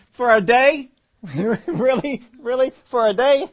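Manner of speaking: high, loud incredulous laughing question; can't believe it, laughing; amused disbelief. Pretend. Preacher laughing in disbelief at the congregation.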